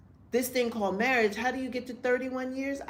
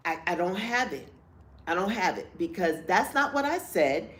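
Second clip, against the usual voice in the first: ordinary delivery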